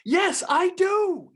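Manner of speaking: high, loud, drawn-out shout; acted scream. Host acting a general screaming back at his commander. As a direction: scream it back slowly, word by word. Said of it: "He screams at him".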